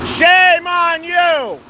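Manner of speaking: slow, high, loud shaming shout; long drawn taunting cry. Real, not acted. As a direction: stretch every word as a public jeer. Protester shouting at officer.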